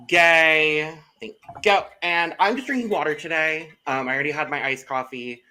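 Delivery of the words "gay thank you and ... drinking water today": camp it up on one loud word; playfully camp. Pretend.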